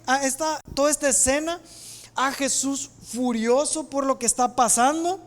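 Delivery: ordinary talk, nothing marked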